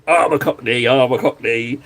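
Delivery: hammed-up Cockney put-on; cartoonish Cockney patter; mock Cockney accent. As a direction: play at being a Cockney, broad vowels. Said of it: "I'd love to sound like a Cockney".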